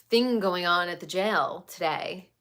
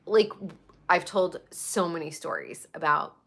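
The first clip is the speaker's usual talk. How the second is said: usual speaking voice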